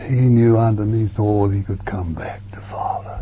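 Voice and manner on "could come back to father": breathy hushed aside; whisper. Acted. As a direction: whisper it like a secret. Sermon aside, tender point.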